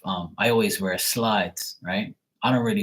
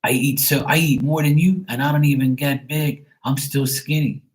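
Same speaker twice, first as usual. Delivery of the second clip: boasting nephew; 6 semitones higher, 4 dB louder than usual. Retold or imitated. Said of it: "And he goes".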